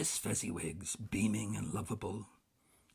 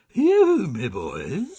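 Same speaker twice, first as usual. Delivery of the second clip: much louder, higher, slow hearty call; a fat jolly master calling out; big oily jovial shout; rich jovial call; 7 semitones higher, 18 dB louder, much slower than usual. A narrator's reading. Fezziwig calls out.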